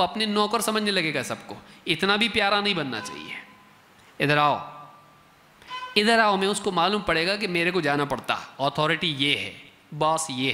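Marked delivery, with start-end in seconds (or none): 4.2-6.4 stern summons. Retold or imitated. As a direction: call the child over with authority. Father sternly summons child. (सख्ती से बुलाना)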